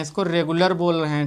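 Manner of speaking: usual speaking voice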